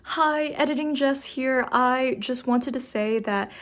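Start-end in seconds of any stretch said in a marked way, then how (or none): none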